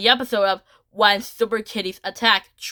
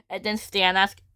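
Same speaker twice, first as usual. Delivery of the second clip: ordinary delivery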